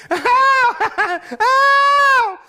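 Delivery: very high loud yelps through laughter; shrieked laughing ow. Real, not acted. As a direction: yelp and laugh at the bite. Bitten while laughing.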